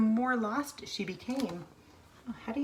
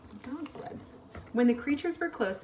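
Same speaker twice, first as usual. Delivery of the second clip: usual speaking voice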